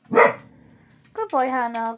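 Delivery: normal talking voice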